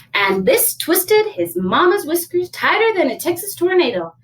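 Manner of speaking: animated storybook voice, lifted high; high, lively read-aloud; story narration. A narrator's reading. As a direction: read it to children with big swoops.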